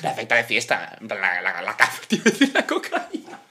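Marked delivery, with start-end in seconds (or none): 1.6-3.4 laughing through the slip of the tongue. Real, not acted. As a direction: giggle while correcting the word. (riéndose al corregirse)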